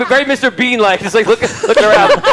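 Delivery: words broken by giggles; laughing while narrating. Real, not acted. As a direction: GM describes the Mister Bean-like loot, laughing through it.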